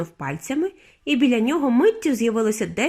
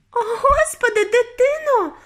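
very high, horrified mother; sudden shriek; 14 semitones higher, 4 dB louder, slower than usual. A narrator's reading. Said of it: "заверещала раптом пані Борогар".

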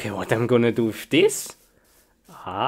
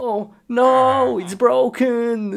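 high, drawn-out lament; high-pitched hammed-up dismay; mock dismay; 8 semitones higher than usual. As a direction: wail it in mock sorrow. Mock lament over broken computer.

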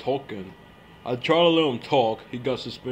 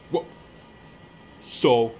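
normal talking voice

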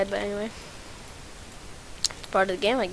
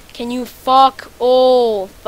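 dragged out, louder fuck off; slow angry demand; 7 dB louder, much slower than usual. Real, not acted. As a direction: stretch each word at the attacker. Enemy targeting him.

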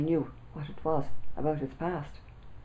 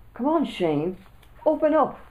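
rising, loud higher call; 9 semitones higher, 6 dB louder than usual. A narrator's reading. Boy calling his friend through a letterbox, loud. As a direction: call it through the door, pushing. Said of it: "I called out through the letterbox".

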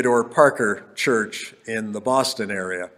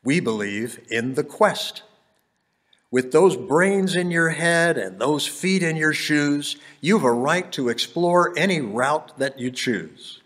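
rhythmic Dr. Seuss style reading; bouncy galloping verse; 4 semitones higher than usual. Acted. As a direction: bounce through the rhymes playfully. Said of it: "wrote in Anapestic tetrameter, and it has that rhymy sound".

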